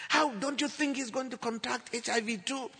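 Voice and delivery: high-pitched alarmed protest; retold shout. Husband fearing for his young son, shouting in protest. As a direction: argue it urgently, voice pitched right up. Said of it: "Ben, don't shout".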